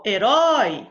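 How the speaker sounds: herói with rói said loud and slow; herói with a loud final rói and a falling end; stress on the last syllable. Shown as a demonstration. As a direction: hit rói hard in herói. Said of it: "a última sílaba foi a mais forte".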